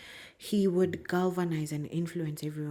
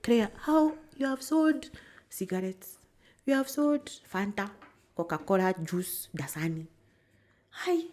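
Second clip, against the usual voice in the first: put-on a light take on his Kenyan English accent, higher and louder; Peter Jenga's accent; 4 semitones higher, 6 dB louder than usual. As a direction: teasingly mimic the boss's Kenyan English accent as he questions her. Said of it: "he's gonna kill me for doing his accent".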